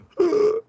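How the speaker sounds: high-pitched sucked-in gasp; imitated death gasp. Demonstrating the noise the dead body made, imitated death gasp. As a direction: imitate a corpse's last gasp of air. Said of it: "heard a dead body go".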